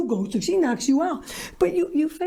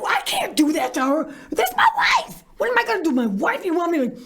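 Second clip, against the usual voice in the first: panicked protest; 3 semitones higher than usual. Retold or imitated. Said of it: "And you're like".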